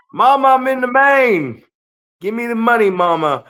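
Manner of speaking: high, loud hammed-up call to mama; mock goofy wrestler voice. Mocking a wrestler calling his mama, high and loud. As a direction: ham up a silly wrestler promo. Mocking dumb locker room lines.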